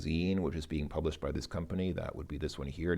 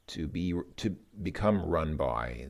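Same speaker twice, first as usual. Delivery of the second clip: ordinary delivery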